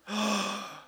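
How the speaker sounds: acted sharp gasp of dread; shocked gasp. Boy told he must face manager, mock-horrified gasp. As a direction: suck in air as if horrified.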